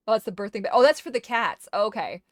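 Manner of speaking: usual speaking voice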